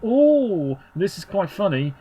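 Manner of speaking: high-pitched, amused "oh" as a casual viewer. Acted. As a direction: mimic an easily tickled casual viewer. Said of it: "the casual viewer".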